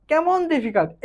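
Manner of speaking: ordinary talk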